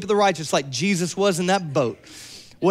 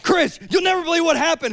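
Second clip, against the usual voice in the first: excited news; 9 semitones higher, faster than usual. Retold or imitated. Excited family telling news.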